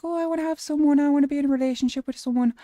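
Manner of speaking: high, loud, rushed Irish-accented mockery; mocking whine. Streamer imitating viewers wanting relationships, mocking Irish-accented whine. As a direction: parody their longing at high pitch.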